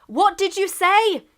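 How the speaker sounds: high, loud and slow call; England calling out. Acted. England shouting over to Russia between tanks, slow loud call. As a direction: shout the question across the gap. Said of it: "he said".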